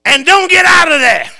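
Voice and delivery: loud, high scolding order; mock stern wife laying down the law; stern wife command. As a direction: bark it like a strict mother. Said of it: "My wife points at her and says".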